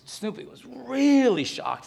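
high, loud and slow; dramatized shock. Acted. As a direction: stretch out his shock for effect. Preacher dramatizes Snoopy's shock.

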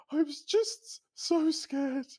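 high, slow, tearful wail; a hammed-up sobbing woman. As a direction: mimic her crying out the words, stretched and high. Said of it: "And she burst into tears".

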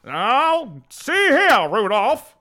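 high, loud gruff reindeer dad; Donner voice. Retold or imitated. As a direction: bark it like a stern dad. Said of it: "That's exactly how he sounds".

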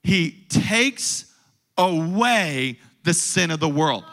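ordinary talk

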